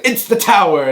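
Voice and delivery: mock movie-hero announcement; imitating Arnold. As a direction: ham up the dramatic reveal. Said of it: "He's like".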